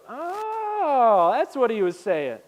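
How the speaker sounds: long high drawn-out "oh"; dawning realization. Retold or imitated. Disciples finally getting the point, mock realization. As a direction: exaggerate the penny dropping, high then settling.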